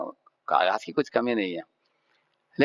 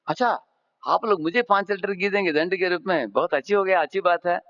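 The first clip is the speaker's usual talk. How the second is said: higher-pitched, acted amazement; storyteller's happily astonished character; delighted surprise; 8 semitones higher than usual. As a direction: voice the offender's pleased disbelief. Offender delighted by ghee punishment.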